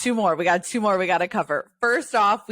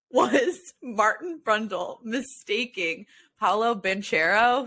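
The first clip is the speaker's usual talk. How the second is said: usual speaking voice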